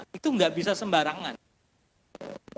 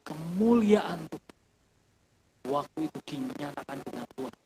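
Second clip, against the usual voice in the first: soft, much quieter, reverent telling over music; hushed storytelling; 5 semitones lower, 8 dB quieter than usual. Acted. Preacher tells the congregation of the weeping taxi driver, hushed and reverent. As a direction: draw the room in close and low.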